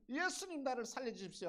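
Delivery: high-pitched urgent cry; a touch of panic, hammed-up lightly; acted plea for rescue. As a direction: call for help as if sinking. Acting sinking Peter's plea for rescue.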